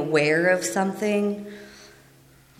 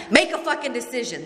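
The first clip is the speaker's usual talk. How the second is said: higher, fast, exasperated command from the sponsor; sponsor's blunt order; 6 semitones higher, faster than usual. Retold or imitated. Sponsor losing patience with her indecisive sponsee, sharp fast command. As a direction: snap it out like a fed-up sponsor. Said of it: "that's what she said to me".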